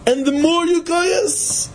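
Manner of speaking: slow, high-pitched emphasis on you; preacher drawing the phrase out high. Real, not acted. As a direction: drag it out high for weight. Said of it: "preacher stressing repeated saying".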